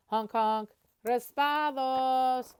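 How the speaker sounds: loud slow street-vendor cry; vendor's call. Acted. Shaved ice vendor announcing his cart, loud slow call.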